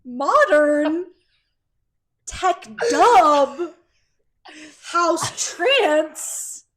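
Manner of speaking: loud, slow, drawn-out hype list; hype announcer. Pretend. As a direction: announce each genre like a headliner. Piling up silly subgenre names.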